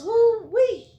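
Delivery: loud, very high two-note whoop; excited high whoop. Real, not acted. Tarot reader thrilled by Pentacles cards, whooping.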